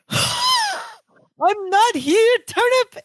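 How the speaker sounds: very high, drawn-out mimic of the cry; copying Twinkasetti. Balky mimics Twinkasetti's cry into the phone, very high and slow. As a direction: echo his panicked squeal, stretched way out. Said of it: "exactly the same way".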